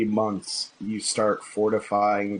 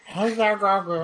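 put-on high ten-year-old boy; little boy voice; 9 semitones higher than usual. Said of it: "just do a little boy voice".

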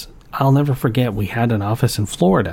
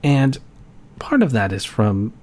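usual speaking voice

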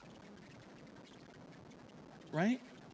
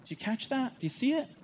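emphatic high question; 10 semitones higher than usual. Real, not acted. Preacher presses congregation, pitch jumps.

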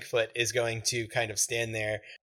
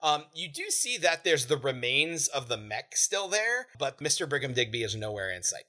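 ordinary talk, nothing marked

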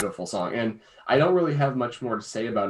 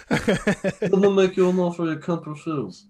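slow Italian mobster voice; Godfather impression; 4 semitones higher, slower than usual. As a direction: drag it out like a mafia don. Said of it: "it was a Godfather reference".

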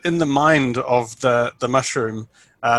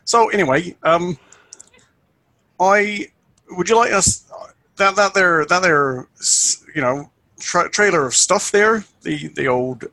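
put-on folksy rural accent; 4 semitones higher than usual. Jackwick folksy, higher.

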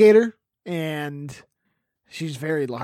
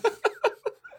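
high squeaky laugh. Real, not acted.

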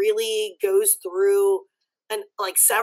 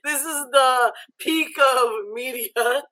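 swooning; 5 semitones higher than usual. Retold or imitated. Retold swooning over the kiss.

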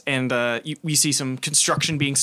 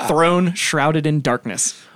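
usual speaking voice

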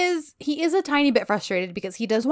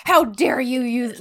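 slow, mildly outraged imitation; mock offended; slower than usual. She takes offense at his offer, mock outrage. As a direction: imitate her taking offense, words drawn out. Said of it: "gets so mad".

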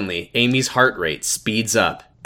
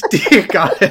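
laughing prayer; 7 semitones higher, 6 dB louder than usual. Acted.

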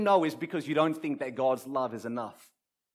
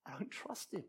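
hushed, far softer confession; quiet inner admission; 17 dB quieter than usual. Acted. Believer privately admits doubting God, hushed. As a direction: say it almost under your breath.

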